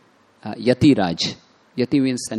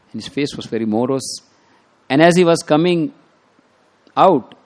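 ordinary delivery

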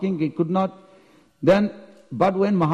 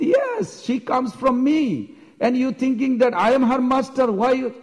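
much higher, mock-haughty voice; hammed-up arrogant claim, pitched high; proud boasting; 8 semitones higher than usual. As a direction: brag as if you were a god. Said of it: "you can be proud".

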